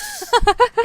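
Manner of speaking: a burst of high-pitched laughter. Real, not acted. Laughing at her own frustration.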